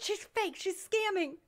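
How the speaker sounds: high, soft mock scream; retold screaming. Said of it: "They were screaming".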